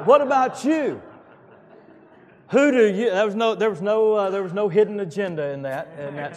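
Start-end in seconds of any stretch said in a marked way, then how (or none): 0.0-4.4 shouted much higher and louder, WHAT punched; booming pulpit challenge; emphatic shout. Shown as a demonstration. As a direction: hurl the question at the crowd. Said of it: "It's emphatic".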